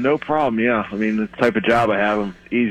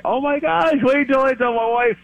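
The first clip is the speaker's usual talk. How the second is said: much higher, gushing with real delight; can't wait to brag to his wife; thrilled; 13 semitones higher than usual. Real, not acted. Caller gushing to the radio host about telling his wife, high and thrilled. Excited caller thinks he's a star.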